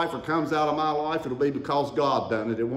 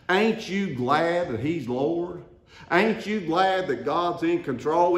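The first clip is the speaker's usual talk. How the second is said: ordinary talk, nothing marked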